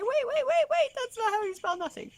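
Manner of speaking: high, loud alarmed stop; mock panicked mom. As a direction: halt them in comic alarm. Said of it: "I had to say".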